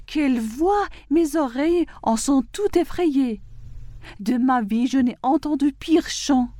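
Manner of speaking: understated, high-pitched outcry; mild exclamation. A narrator's reading. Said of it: "ma fille s'écria".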